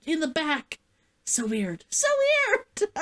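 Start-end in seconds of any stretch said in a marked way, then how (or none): 1.9-2.9 high squealed repeat. Real, not acted. Reacting to seeing her hair, high squeal. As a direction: squeal it the second time.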